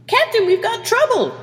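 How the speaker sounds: high, loud panicked yelp. Acted. Communications officer alerts his captain, loud yelp. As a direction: yelp the alarm in a squeaky voice. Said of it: "he yelped".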